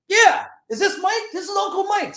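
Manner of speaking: very high put-on voice; imitated uncle. Uncle answering his nephew's call, high-pitched imitation. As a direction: push the pitch way up, hammed-up caller.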